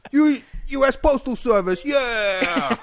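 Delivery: high, shouted mock New York cheer; New Yorker accent. New Yorker cheering postal service, loud mock New York accent. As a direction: whoop it up as a cartoon New Yorker.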